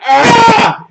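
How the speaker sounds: loud, very high yell of dismay; re-enacted anguished scream; short high-pitched frustrated cry. Retold reaction to stream restarting.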